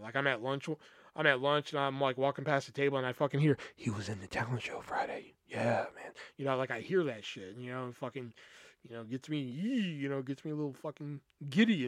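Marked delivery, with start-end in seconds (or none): 3.8-6.1 retold whispers. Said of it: "I hear the whispers".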